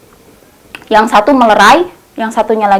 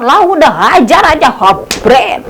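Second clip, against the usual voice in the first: provocateur's jeer; 2 semitones higher, 3 dB louder than usual. Retold or imitated. Provocateur in the crowd urges everyone to beat him. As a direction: whip the mob into hitting him. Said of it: "ada satu warga yang provokator".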